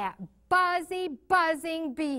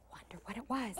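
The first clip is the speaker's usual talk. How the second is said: whisper; 3 semitones lower, 12 dB quieter, faster than usual. A narrator's reading. Hushed wonder to child.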